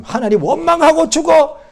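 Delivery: high, loud imitation of a bitter wife; bitter outburst. Job's wife to her suffering husband, bitter outburst. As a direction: spit out the curse, pitched high. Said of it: "요업의 부인이 말한 것처럼".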